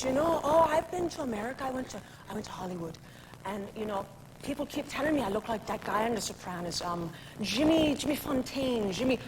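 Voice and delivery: put-on light Irish accent of a tipsy pub man; a hint of a Dublin bar regular; a lightly voiced Irish drinker. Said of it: "that was Jimmy Fontaine I just did".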